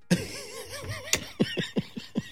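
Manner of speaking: high burst of laughter. Real, not acted. Laughing at his own story.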